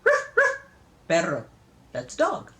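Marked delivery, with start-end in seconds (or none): 0.0-0.6 high, loud imitated barks; ordinary dog bark. Grandma showing how all dogs go, plain barking. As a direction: bark like any dog anywhere. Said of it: "just like all the other dogs".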